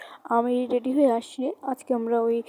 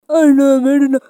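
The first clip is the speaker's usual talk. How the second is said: loud pleading; 11 dB louder than usual. Acted. Victim pleads for her life with the ghost, loud pleading. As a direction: beg the attacker to stop. Begs attacker to stop.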